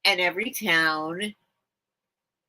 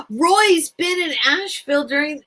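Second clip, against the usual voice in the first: higher, louder outburst; excited gasp; 8 semitones higher, 6 dB louder than usual. Real, not acted. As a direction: blurt it out with a gasp. Sudden realisation.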